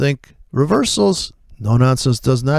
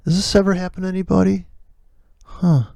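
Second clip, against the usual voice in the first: usual speaking voice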